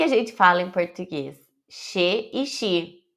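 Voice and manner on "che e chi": like Portuguese X; Portuguese sh sound. Shown as a demonstration. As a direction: say "Che e chi" as she and shi. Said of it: "como se tivesse som de X".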